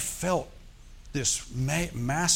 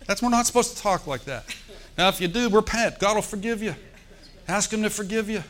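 preaching at full force; 8 semitones higher than usual. Acted. Pastor warning the congregation about swearing, fervent preaching. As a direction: drive the warning home from the pulpit.

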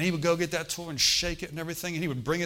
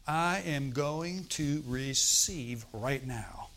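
slow determined vow; much slower than usual. Shown as a demonstration. Preacher models determined words.

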